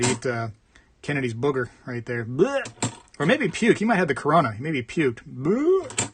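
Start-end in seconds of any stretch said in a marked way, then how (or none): 2.2-2.7 disgusted blech. Real, not acted. Disgust at booger on coin. 5.3-6.1 higher gagging blech; a retching blech at the dirty coin; disgusted blech. Real, not acted.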